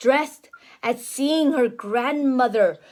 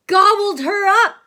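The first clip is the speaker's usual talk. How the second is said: loud, higher, big dramatic telling; 5 semitones higher, 6 dB louder than usual. A narrator's reading. Mother reading to her toddler, loud and dramatic. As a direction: make it a thrilling climax.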